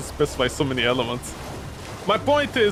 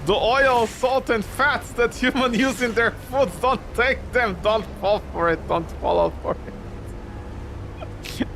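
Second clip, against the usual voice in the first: much higher, pleading voice of a tiny dog; urgent pug warning; 6 semitones higher than usual. Pretend. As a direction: warn them like a prophet pug. Pug warning against human food.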